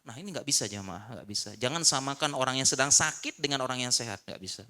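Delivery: ordinary delivery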